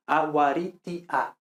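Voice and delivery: "Aguaritia" slowly as a-wa-RI-ti-a; slow, separated "Aguaritia" with a pure T; T always T. Shown as a demonstration. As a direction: keep the T hard in "Aguaritia". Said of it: "pronunciamos siempre P, T, K".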